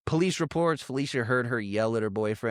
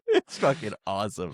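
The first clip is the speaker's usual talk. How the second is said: ordinary talk, nothing marked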